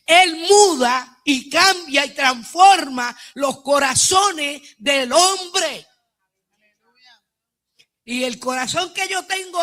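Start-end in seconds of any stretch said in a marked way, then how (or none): 0.1-5.9 slow, loud, every word driven home; fervent preaching shout. Real, not acted. Woman testifying to the church, preaching at full force. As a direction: proclaim it to the congregation with full force. Preacher proclaiming God changes hearts.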